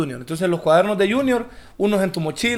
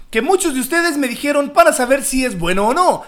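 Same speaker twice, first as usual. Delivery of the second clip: higher, hyped product-tester voice; Mexican YouTuber voice; 7 semitones higher, 3 dB louder than usual. Retold or imitated. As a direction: imitate an eager reviewer host. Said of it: "él habla bien raro".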